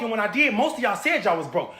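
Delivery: normal talking voice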